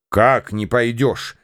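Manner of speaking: usual speaking voice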